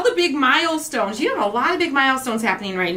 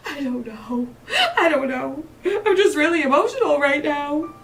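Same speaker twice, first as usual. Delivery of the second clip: half laughing, half crying, a touch higher; laughing through tears; 4 semitones higher than usual. Retold or imitated. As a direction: giggle and tear up at once. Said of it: "Are you crying?".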